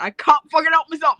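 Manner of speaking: ordinary talk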